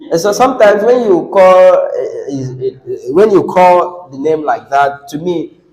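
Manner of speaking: ordinary talk, nothing marked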